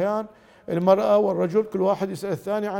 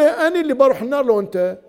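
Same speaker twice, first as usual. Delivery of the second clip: higher, louder defiant comeback; mock defiant retort; 6 semitones higher, 8 dB louder than usual. Wife snapping back at her husband, mock defiant. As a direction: throw it back at him, mildly hammed-up. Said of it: "تقول لي".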